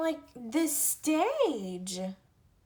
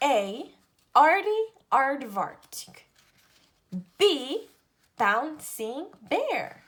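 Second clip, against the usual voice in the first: lively picture-book read-aloud, each letter set apart; reading to children; slower than usual. Acted. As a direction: read an alphabet book to little kids. Reads alphabet book to children.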